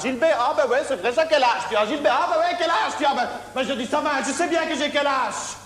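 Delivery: high dopey character voice; high put-on voice. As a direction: play the dopey Gilbert high.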